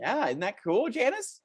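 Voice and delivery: put-on chirpy children's show host talking to kids; lightly voiced kids' TV host, upbeat. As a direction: sell it like a cheesy kids' show presenter.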